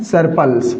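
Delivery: सरप्लस with the r sounded, as many say it; common mispronunciation. Shown as a demonstration. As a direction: say सरप्लस pronouncing the r after the vowel. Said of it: "हमारे कुछ साथी क्या उच्चारण करते हैं?". (सरप्लस, आर की ध्वनि के साथ)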